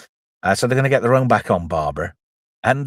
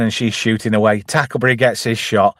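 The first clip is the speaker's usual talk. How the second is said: usual speaking voice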